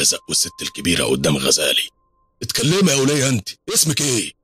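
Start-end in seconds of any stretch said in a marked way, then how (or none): 2.4-4.3 high-pitched bullying challenge; acted indignant, domineering voice; rough demand. A narrator's reading. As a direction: summon her imperiously. Thug interrogates the old woman.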